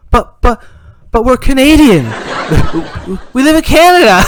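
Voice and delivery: high, loud, stammering objection; baffled protest. Acted. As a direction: plead your case, incredulous. Dad protests to his daughter.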